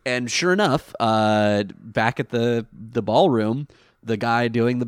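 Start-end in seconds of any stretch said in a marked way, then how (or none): none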